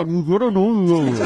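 muffled behind a hand; hand over mouth. Shown as a demonstration. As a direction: speak through covered mouth. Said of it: "捂着个嘴说话".

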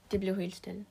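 usual speaking voice

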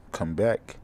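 ordinary delivery

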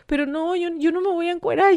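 high-pitched retold protest; nearly crying. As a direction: plead as if close to tears, lightly acted. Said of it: "casi, casi llorando".

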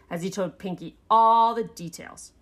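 ordinary delivery, nothing marked

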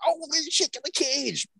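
very high, strained mock cry; mock panic. Cat catcher calling for the cage, mock panic. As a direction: call out in squealing, hammed-up panic.

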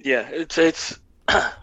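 croaky, throat catching; hoarse. Real, not acted. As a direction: voice cracking after clearing throat. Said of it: "I don't know what's wrong with me".

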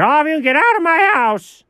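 high, blustering order; high-pitched comic eviction; Alfred accent. Shown as a demonstration. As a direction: bluster it out high, throwing everyone out. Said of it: "I can do an Alfred accent".

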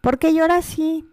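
high, loud mock of a stranger asking "¿por qué llora así?"; mocking nosy stranger. Mother imitating what strangers say about her daughter's cry, mock high and loud. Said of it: "cuando dicen eso".

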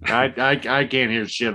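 ordinary delivery

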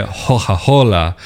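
Coca-Cola said as Hoja-Hola, each hard K softened to an H; "Hoja-Hola" with breathy H sounds where the K's go; Tuscan local pronunciation. Shown as a demonstration. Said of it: "that hard K becomes a H".

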